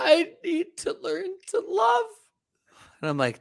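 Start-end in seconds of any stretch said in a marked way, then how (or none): 0.0-2.1 high mock voice. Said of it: "she's like".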